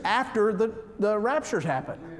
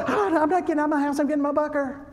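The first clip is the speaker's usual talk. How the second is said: fast, higher mocking voice of a panicked prepper; mock scared prepper; 8 semitones higher, faster than usual. Preacher mocking a prepper who won't leave his house, fast and higher. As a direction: rattle it off like someone hiding from the world.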